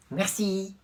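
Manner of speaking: ordinary delivery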